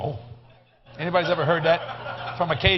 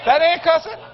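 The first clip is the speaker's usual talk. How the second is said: emphatic; 13 semitones higher, 11 dB louder than usual. Real, not acted. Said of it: "preacher insists to congregation".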